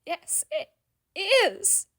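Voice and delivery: high, loud tearful protest; wailing. A narrator's reading. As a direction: wail it back at him. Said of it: "another piercing wail".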